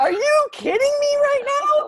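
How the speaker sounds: high shouted disbelief; real shouted outrage at the reply; outraged shout. Real, not acted. Outrage at the email.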